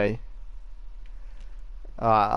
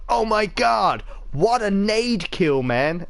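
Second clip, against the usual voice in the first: hammed-up American gamer accent, higher and slow; mock American accent; 7 semitones higher, slower than usual. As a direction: send up a hyped American gamer in his accent. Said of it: "a load of Yanks going".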